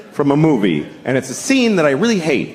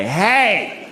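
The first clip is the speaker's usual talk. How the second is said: high, drawn-out mock taunt; 5 semitones higher, much slower than usual. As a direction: imitate a cocky taunt, stretching the question. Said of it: "he decides to taunt him".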